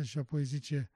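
usual speaking voice

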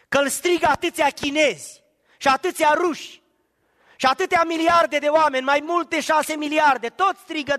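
high, loud, impassioned preaching; fervent pulpit cry; preaching at full force. Acted. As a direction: drive the congregation to the peak. Preacher builds to a climax.

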